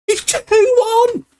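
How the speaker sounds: very high, breathless goal shout; thrilled goal call. Real, not acted. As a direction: squeal the score out in delight. Goal scored, score goes 2-1.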